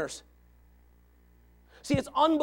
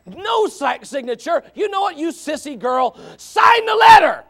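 high, loud challenge, last words drawn out; taunting shout; 7 semitones higher, 11 dB louder, slower than usual. Acted. Preacher challenging an unsigned letter writer, taunting shout. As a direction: shout down a cowardly critic.

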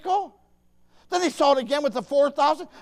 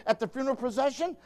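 ordinary talk

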